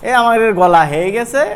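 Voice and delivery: ordinary talk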